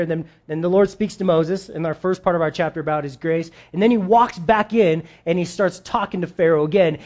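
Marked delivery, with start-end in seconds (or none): none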